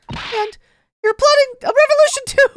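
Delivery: put-on high-pitched girl, shocked reproach; high accusing girl voice. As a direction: accuse him in a high girlish voice. Heroine accusing.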